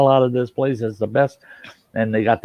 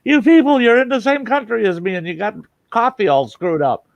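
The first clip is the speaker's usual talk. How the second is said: much higher and louder mock exasperation; retold exasperated outburst; 10 semitones higher, 4 dB louder than usual. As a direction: blast them as if fed up with their coffee.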